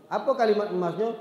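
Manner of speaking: normal talking voice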